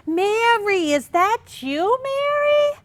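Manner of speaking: a lightly voiced fake-surprised neighbour; imitating Margot. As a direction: gush at her friend like a nosy acquaintance. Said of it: "and Margot said".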